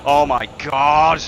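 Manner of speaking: loud, drawn-out cry of shock; shocked shout. Real, not acted. Racer reacting to a heavy crash, shocked shout.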